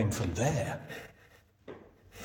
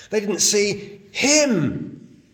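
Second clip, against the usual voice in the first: slow, higher, "him" hit hard; stress on him; 8 semitones higher, 4 dB louder, much slower than usual. Shown as a demonstration. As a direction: drag it out and land on "him". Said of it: "a thing that Greek does to emphasize something".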